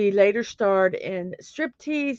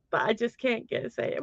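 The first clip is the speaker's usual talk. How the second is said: normal talking voice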